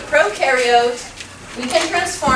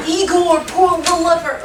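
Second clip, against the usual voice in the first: mad scientist; 6 semitones higher, 4 dB louder than usual. Retold or imitated. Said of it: "Not like".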